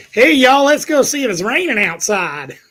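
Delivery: high, loud Southern twang that slows and stretches out; mock Southern accent. Teasing about Weather Channel accents, exaggerated Southern drawl. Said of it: "You don't hear 'em talk like that".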